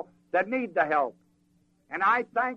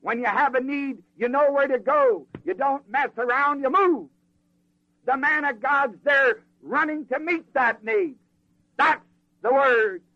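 preaching build; 6 semitones higher, 4 dB louder than usual. Acted.

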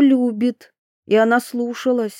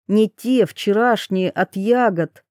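usual speaking voice

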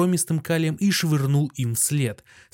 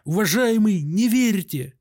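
ordinary delivery

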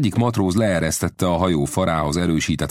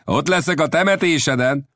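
gleeful captain's taunt, pitched up; taunting call back; 12 semitones higher than usual. A narrator's reading. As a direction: jeer back across the water.

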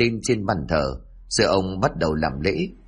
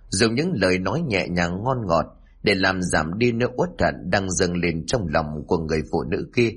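plain reading voice

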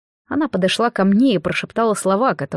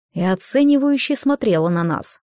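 ordinary delivery, nothing marked

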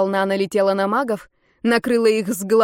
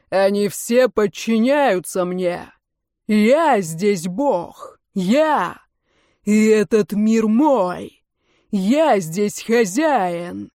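very slow, imperious proclamation; grandiose villain; much slower than usual. A narrator's reading. Лжевечный claiming the world as his, imperious. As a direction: stretch each claim like a self-declared god.

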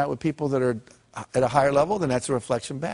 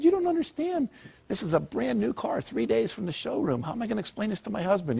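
much higher, tearful pleading voice; upset crying woman; 7 semitones higher, 4 dB quieter than usual. Retold or imitated. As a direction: play the panicked wife near tears over her new car. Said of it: "and she's crying".

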